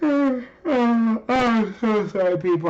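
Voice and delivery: yawning through the words; slow, stretched yawn while talking. Real, not acted. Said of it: "if I'm yawning".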